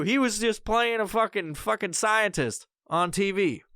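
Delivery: normal talking voice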